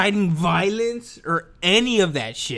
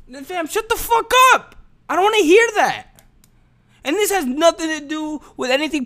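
exasperated outburst; 9 semitones higher than usual. Real, not acted. Ranting at a pundit he finds fake, exasperated outburst. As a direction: snap at him to stop talking.

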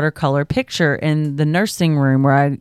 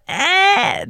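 put-on high whiny baby "ah"; baby whine. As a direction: whine like a toddler demanding. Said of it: "when she does that".

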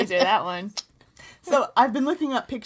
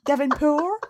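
put-on tentative French-accented name, rising and higher; bad French accent; 4 semitones higher, 3 dB louder than usual. Host tries a French version of partner's name, bad French accent. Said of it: "sounds like it could be French".

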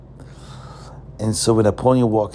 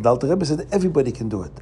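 usual speaking voice

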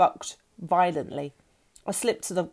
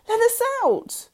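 much higher, loud shriek; 5 dB louder than usual. A narrator's reading. Lauren, trapped below deck, shrieks for help. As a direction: scream it for help in panic. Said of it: "I shrieked".

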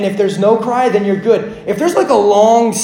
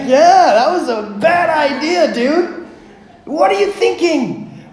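high-pitched mock scolding; acted parental exasperation; exasperated father; 8 semitones higher, slower than usual. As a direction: tell off your kid in disbelief. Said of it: "fear and trepidation turned to anger".

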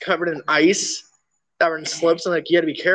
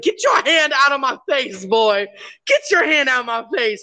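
raised-pitch mock grandpa scolding; higher, shoving the kid off like an irritated old man; annoyed grandpa; 9 semitones higher than usual. Grandpa to grandson waving a smelly hand, higher mock scold.

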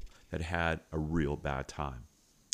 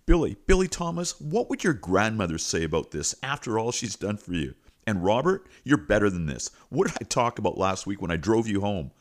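loud, emphatic mock telling-off; retold scolding; 2 semitones higher, 6 dB louder than usual. Principal reproaches boys by name, imitated loud scolding.

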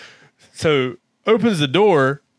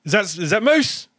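mock puzzled quote, pitched higher; acting out Spud; 8 semitones higher than usual. Spud at the door, mock puzzled, higher. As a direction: lightly imitate a colleague checking who walked in. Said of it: "And Spud goes".

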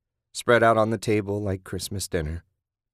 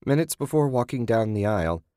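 ordinary delivery, nothing marked

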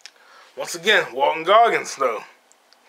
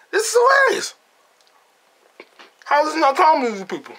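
incredulous laughing; 12 semitones higher than usual. Retold or imitated. Imitating himself laughing alone in a silent theater, high and incredulous. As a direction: act out cracking up while no one else does. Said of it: "I'm like".

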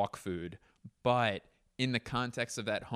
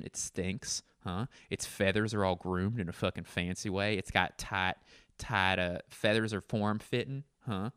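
ordinary delivery

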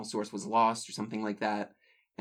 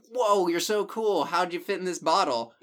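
impressed; 6 semitones higher, 3 dB louder than usual. Retold or imitated. Fisherman flatters the genie.

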